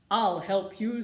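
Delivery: a touch of a brave knight. Acted. Knight offering the unicorn help, a touch heroic. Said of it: "said the knight".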